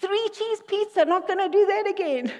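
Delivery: a playful mock voice for the talking bush; joking burning bush voice. Preacher jokes, voicing the bush to Moses in mock voice. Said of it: "the bush starts talking to him".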